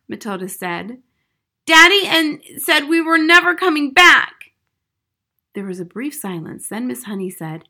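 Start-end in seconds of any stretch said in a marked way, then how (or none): 1.6-4.5 high, loud lament; distraught child. A narrator's reading. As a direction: report it in loud dismay.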